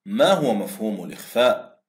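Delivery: normal talking voice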